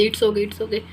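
ordinary talk

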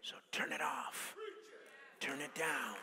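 forceful stage whisper; emphatic hushed voice. Acted. Preacher voicing God's order to cut the noise, forceful stage whisper. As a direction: press the command through a hush. Continues whispered words of the Spirit.

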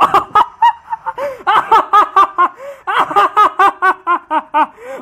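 long, high-pitched laughing fit. Real, not acted. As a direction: burst into real, uncontrolled high laughter. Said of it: "I'm laughing".

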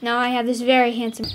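ordinary delivery

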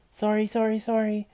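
high, loud, panicky apologising; flustered apology. A narrator's reading. Olivia apologises after dropping tray.

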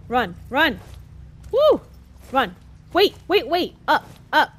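urgent shouting while fleeing; being chased. Real, not acted. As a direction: yell it like something's right behind you. Running from enemies in game.

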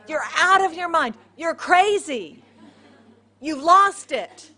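acted incredulous rebuff; scoffing disbelief. Praying Christians answer the servant girl, scornfully disbelieving.

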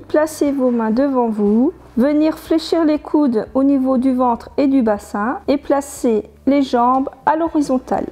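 ordinary talk

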